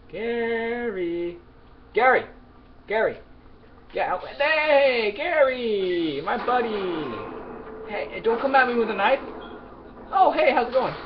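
high, loud sing-song calling out for Gary; mock friendly calling. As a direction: fake cheerful greeting before the attack. Hunting Gary, calling him out.

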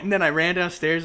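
usual speaking voice

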